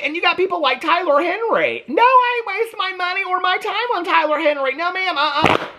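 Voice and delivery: high, sassy put-on voice; sassy dismissal. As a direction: toss it off like a scornful auntie. Sassy rant dismissing Tyler Henry.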